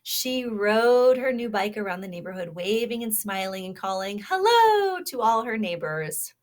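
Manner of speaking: lively storybook read-aloud; reading to children. Acted. Teacher reading a kindness story to children, animated. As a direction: read it like a picture book to little listeners.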